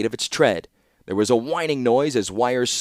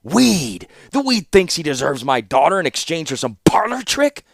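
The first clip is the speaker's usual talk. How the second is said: higher, louder scornful outburst; angry rant; 6 semitones higher, 4 dB louder than usual. A narrator's reading. Warlord scorning his daughter's suitor, angry and loud. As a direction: spit out the insult.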